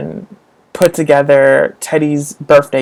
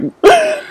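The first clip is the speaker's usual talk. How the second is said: laugh. Real, not acted. Laughing before mock cry.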